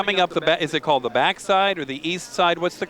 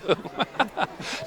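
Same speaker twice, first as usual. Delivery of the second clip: real breathy chuckle at a joke; light, rippling laugh; amused laugh; 5 semitones higher, 7 dB quieter than usual. Real, not acted. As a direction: let a string of chuckles out. Laughs after trading chicken jokes.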